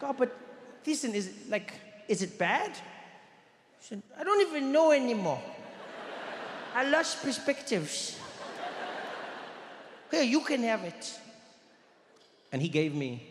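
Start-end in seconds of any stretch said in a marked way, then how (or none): 0.0-2.7 put-on young boy voice. Young [name] asks his friend. 4.2-11.2 put-on weary little boy. Young Thiessen handing over his lunch, high resigned boy voice.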